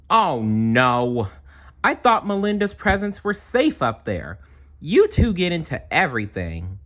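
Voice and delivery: high, loud dismayed cry; upset girl. Acted. Melinda finds her presents wrecked, high and dismayed. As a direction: wail like a girl whose gifts are ruined.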